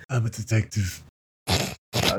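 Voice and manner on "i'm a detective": an accidental hint of Trump; Donald Trump voice. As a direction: drift into a light Trump impression. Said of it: "that sounded like Donald Trump".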